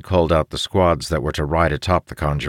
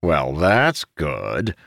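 slow, dry sarcasm; sarcastic; much slower than usual. Acted. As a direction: drawl it out sarcastically. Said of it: "one of the men said sarcastically".

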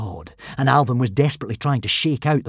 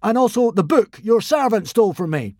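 crying out; 10 semitones higher, 6 dB louder than usual. Acted. Fake god crying out to the consul. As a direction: call it out grandly. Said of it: "cried Hiccup".

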